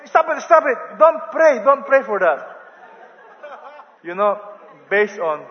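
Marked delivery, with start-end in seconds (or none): 0.1-2.4 much higher and louder alarmed cry; the man cries out to stop the prayer; panicked protest. Retold or imitated. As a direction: stop the pastor's prayer in a hurry. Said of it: "The guy said".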